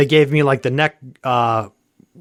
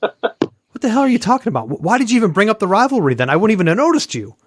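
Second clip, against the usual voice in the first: higher-pitched, incredulous mock protest; exasperated retort; 7 semitones higher than usual. Speaker retelling his reply to a so-called rival, exasperated. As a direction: act out a baffled, quick-fire objection.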